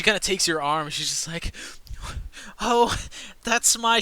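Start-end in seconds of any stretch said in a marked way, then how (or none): 2.6-4.0 flustered Tilda. Acted. GM voices flustered NPC Tilda.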